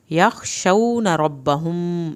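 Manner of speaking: یخشون ربهم slowly, pause on مم of ربهم; hold the meem. Shown as a demonstration. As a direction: linger on ربهم. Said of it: "یہاں پر ہم نے دیر اس لیے لگائی".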